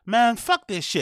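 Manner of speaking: mildly mocking a stand-up's exaggerated line; mock comic's voice. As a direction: lightly send up the comedian's delivery. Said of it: "you say it in that weird, you know, voice".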